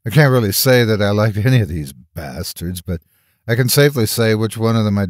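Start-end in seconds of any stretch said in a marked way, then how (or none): none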